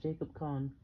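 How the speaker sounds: ordinary delivery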